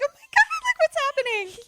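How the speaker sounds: loud, very high panic; shrill pretend group freak-out; mock freaking out. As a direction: squeal it in hammed-up panic. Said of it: "freaking out".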